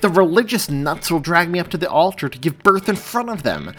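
high, loud rant; heated, scornful protest; incredulous. A narrator's reading. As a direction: rant as if he's crazy. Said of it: "in such a tone that suggested Joey had lost his mind".

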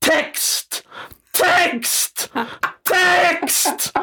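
high, hammed-up calls of Text; comic offstage yells; yelling for the text. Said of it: "och skriker".

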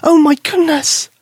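put-on high, slow amazed girl; excited exclaim. Susie seeing the silver cross, slow high amazed exclaim. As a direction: gasp it out in wonder. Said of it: "Susie exclaimed".

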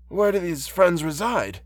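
put-on high-pitched, gentle old blind man; kindly old man. Old man questions the visitor.

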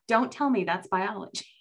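normal talking voice

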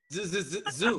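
stuck on the first sound, then out; mock stutter. Friend imitating a stuttering Zoom, teasing stammer. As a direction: stammer on the Z before the word lands. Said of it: "just like you be stuttering".

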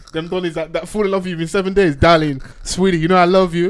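put-on Nigerian accent. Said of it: "understand the accent".

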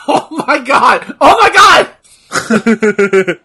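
slow, loud, laughing exclamation; laughing disbelief. Real, not acted. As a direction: stretch it out mid-laugh. Laughing at the match.

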